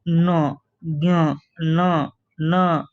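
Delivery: न and ज्ञ sounded through the nose, drawn out; nasal sounds demo. Shown as a demonstration. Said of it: "जैसे ये अनुनासिक अ".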